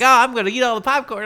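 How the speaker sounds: high, loud, fast teasing dad; mock-playful popcorn thief. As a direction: goof around like a dad with his kids. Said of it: "he's very playful".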